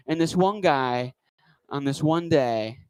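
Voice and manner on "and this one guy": ordinary delivery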